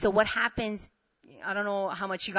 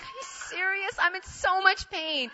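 high-pitched mock wail, stretching the words; high, pained disbelief; exasperated agony; 10 semitones higher than usual. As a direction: complain through comic pain. Her agonized retort to him.

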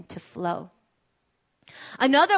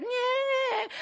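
put-on fussy toddler; 4 dB quieter than usual. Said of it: "Olivia's tired".

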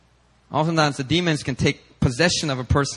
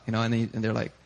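ordinary delivery

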